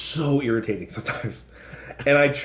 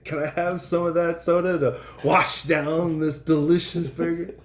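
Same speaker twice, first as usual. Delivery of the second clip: higher put-on movie-gangster voice, laughing in; Pulp Fiction gangster; 5 semitones higher than usual. Host continuing the Pulp Fiction scene, hammed-up gangster. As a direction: savour every word like a menacing hitman. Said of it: "Pulp Fiction".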